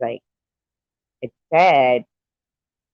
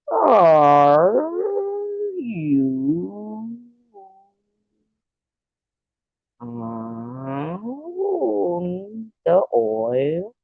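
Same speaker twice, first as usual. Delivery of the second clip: put-on character voice; 7 semitones higher, much slower than usual. Cartoon voice.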